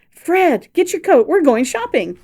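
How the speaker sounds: high, loud, joyful call. A narrator's reading. Wife to husband inside, joyful loud call. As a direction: call him from the doorway. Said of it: "she turned and called".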